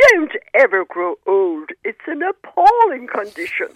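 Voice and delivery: slow mock-grand Anglo-Irish voice; Anglo-Irish accent. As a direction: imitate a weary grand old Anglo-Irish lady, slow. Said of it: "in her best Anglo-Irish accent".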